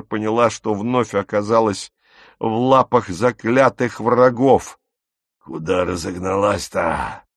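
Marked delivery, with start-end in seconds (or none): 5.4-7.2 low, husky taunt; lightly hoarse thug voice; wheezy husky voice. Acted. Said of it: "просипел чей-то голос".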